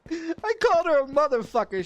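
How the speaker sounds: loud, high, cracking up; gleeful, high-pitched repeat between laughs; laughing boast. Real, not acted. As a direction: crow it out through laughter.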